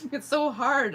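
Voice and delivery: slow, high laugh through the words. Real, not acted. Confessing she can't help being judgy. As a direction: admit it while still laughing.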